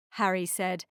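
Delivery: ordinary delivery, nothing marked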